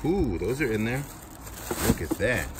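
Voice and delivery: ordinary talk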